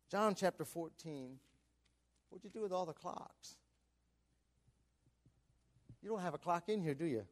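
ordinary talk

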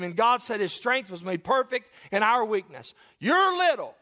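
loud, high, driving pulpit voice; full-force preaching. Real, not acted. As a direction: preach it out with force, peaking at the end.

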